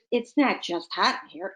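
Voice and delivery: ordinary talk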